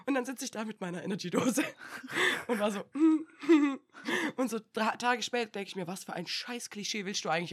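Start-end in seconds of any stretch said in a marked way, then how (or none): 2.5-4.4 high helpless laughter; real laughing fit; laughing hard. Real, not acted. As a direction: crack up at the memory. Laughing at own story.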